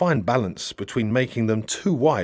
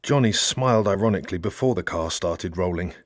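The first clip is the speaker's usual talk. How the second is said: plain reading voice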